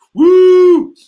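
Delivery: high, loud cheering whoop; excited whoop. Real, not acted. Host whooping loudly to welcome UK viewers. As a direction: cheer big for the UK crowd.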